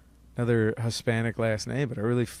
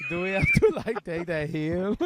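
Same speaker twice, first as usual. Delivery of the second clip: American soldier impression; 4 semitones higher than usual. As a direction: put on a joking immigrant GI voice. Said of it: "I'm doing an American soldier right now".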